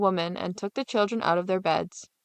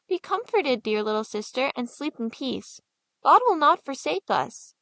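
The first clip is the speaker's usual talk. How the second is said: gentle comfort; 4 semitones higher than usual. Acted. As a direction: soothe her to sleep. Hansel comforts sister.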